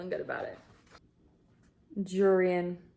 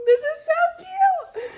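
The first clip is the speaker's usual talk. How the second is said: much higher and louder, laughing; giddy squeal; 9 dB louder than usual. Real, not acted. Reader gushing over the romance, squealing. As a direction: squeal with delight.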